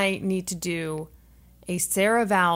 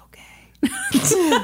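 giggly whisper; 12 semitones higher than usual. Real, not acted. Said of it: "Why are we whispering?".

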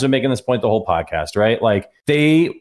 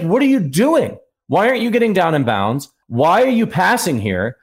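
higher, incredulous reproach; exasperated disbelief; 5 semitones higher than usual. Retold or imitated. Fan berating the team over out of bounds, exasperated. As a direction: demand an answer like an exasperated fan. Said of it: "And I was like".

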